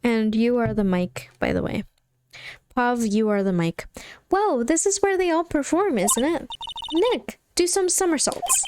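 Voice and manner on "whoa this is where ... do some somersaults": high, excited sidekick voice; eager sidekick. Acted. Sidekick to Nick on the circus stage, high and eager. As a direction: pitch it up, egging a partner on.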